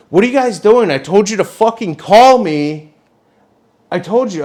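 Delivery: high-pitched, indignant telling-off; exasperated scolding. Retold or imitated. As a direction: scold them in retold exasperation. Retold scolding of the intruders.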